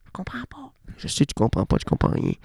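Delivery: soft secret whisper. Pretend. Whispering to a confused friend about the hidden bar. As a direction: whisper like sharing contraband. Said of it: "On est dans la clandestinité".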